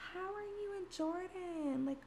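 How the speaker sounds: mimicking a client's sugary question; cheery client voice. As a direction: imitate a bubbly client asking, slow and sweet. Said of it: "My clients always ask me".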